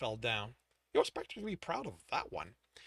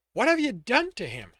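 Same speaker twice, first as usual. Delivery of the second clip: alarmed; 8 semitones higher, 12 dB louder than usual. Acted. Woman alarmed at his state.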